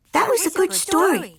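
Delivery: put-on high-pitched little girls' voice; kitten sisters. A narrator's reading. Kitten sisters thank mama for the story, high voices. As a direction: pipe it up high. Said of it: "said the sisters".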